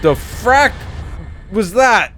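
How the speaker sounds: loud, high startled outburst; startled shout. Real, not acted. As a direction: blurt it out in shock. Sudden scare in game.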